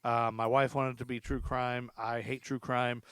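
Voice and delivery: ordinary talk, nothing marked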